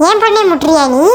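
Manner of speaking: low-pitched scolding challenge; angry mother. Acted. Mother confronts daughter's bully. (மகளுக்காக அதட்டும் அம்மா)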